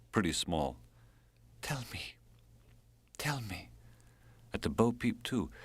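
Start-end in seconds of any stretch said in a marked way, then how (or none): 1.6-2.2 hushed, higher pleading; dying lover begging to be told stories; soft plea. Acted. Dying lover begs softly. 3.2-3.7 unvoiced, pleading whisper; dying lover begging to be told stories, whispered; whispered plea. Dying lover begs softly.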